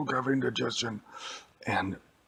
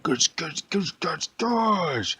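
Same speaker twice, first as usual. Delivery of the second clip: smash sound effect; 8 semitones higher, 3 dB louder, much slower than usual. Acted. Hulk smashing the leg.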